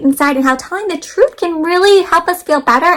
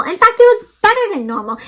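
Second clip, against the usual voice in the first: ordinary delivery, nothing marked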